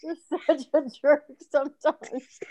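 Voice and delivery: laughing. Real, not acted. Laughing at teasing.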